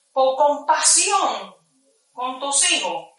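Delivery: very slow, drawn-out mock solemn; mock solemn interrogator. Kenia the interrogator offering release, slow and mock solemn. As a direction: stretch each word like a false favor. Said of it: "me dijo Kenia así".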